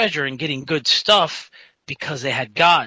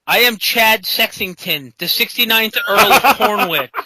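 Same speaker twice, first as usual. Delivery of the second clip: mock-heroic self-announcement, pushed louder at the end; Chad Sexington; 4 semitones higher, 4 dB louder than usual. Paladin announces himself to the party, pompous and over the top. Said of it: "fists on his hips as his head jerks".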